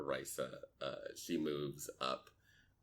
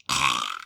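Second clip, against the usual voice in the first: loud, low creature "aah"; abrupt lizard-like noise, pitched down; lizard folk cry; 4 semitones lower, 13 dB louder than usual. Acted. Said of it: "and he just goes".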